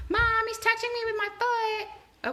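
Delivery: put-on high whiny kid tattling; whiny tattling little sibling.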